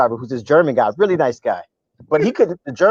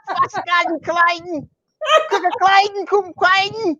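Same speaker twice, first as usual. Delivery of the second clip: high-pitched ranting German bus driver; mock German cussing; 12 semitones higher than usual. Comedian mimics bus driver cussing out friend, ranting German. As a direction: rant in fake, furious German, much higher. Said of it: "is cussing him out in German".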